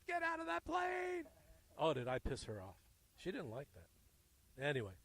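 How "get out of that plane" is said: high mock shout from his wife; retold angry yell. Wife shouting at her husband over the phone, mock yell. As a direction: yell it like a wife telling him to get out of the plane. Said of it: "and she goes".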